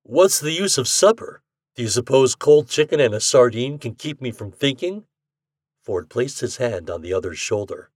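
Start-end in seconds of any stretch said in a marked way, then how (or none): 0.0-5.1 a lightly voiced brash young gambler, louder; slightly louder, easy-going voice for Ashton; a hint of a cocky bettor. A narrator's reading. Ashton scoffing.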